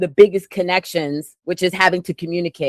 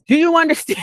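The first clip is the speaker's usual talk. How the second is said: scolding brother; 9 semitones higher, faster than usual. Retold or imitated. Scolding brother in retold call.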